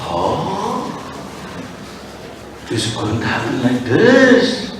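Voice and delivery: soft, low, uneasy queen; a queen hushed by doubt, quiet and low; worried queen. Acted. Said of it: "Kaikeyi is like".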